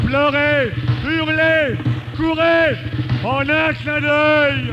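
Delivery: high, loud, driving recital; frenzied epic declamation. Acted. Reciter conjuring the giant spectre of rout, frenzied declamation. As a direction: hurl out each phrase at full force.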